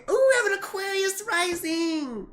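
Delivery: high, loud excited squeal; light up over the discovery; delighted. Real, not acted. Astrologer to viewers, squealing at an Aquarius rising.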